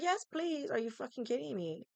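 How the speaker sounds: retold exasperated plea, starting high; re-enacted frustrated incredulity. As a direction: jump up high in disbelief, then ease down. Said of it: "I was very frustrated".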